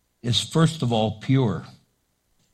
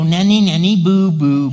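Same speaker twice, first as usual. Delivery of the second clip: sing-song playground taunt, louder; childish taunt; 2 semitones higher, 8 dB louder than usual. Retold or imitated. Preacher mocking grown-ups who taunt like kids, childish sing-song. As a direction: tease like a kid on the playground. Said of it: "We don't go".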